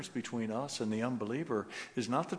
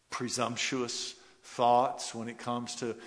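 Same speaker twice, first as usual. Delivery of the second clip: ordinary talk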